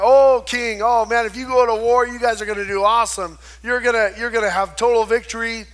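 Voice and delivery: hyped-up flattery. Retold or imitated. False prophets flattering King Ahab, loud and hyped. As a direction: sell the king a sure win, pumped up.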